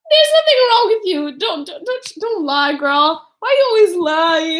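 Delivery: high, loud opening that drops; mock scolding. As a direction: imitate a relative dismissing the complaint. Retelling Hoyo's scolding reply.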